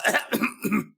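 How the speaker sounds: evil laugh breaking into coughs; hacking cough that spoils the gloating; coughing fit. Acted. Hooded figure choking on dust, coughing.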